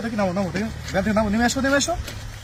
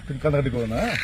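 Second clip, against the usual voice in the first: lower, gentle soothing voice; hushed, sympathetic reassurance; consoling; 3 semitones lower, 5 dB quieter than usual. Real, not acted. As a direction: soothe someone who is crying. Consoling the crying vendor.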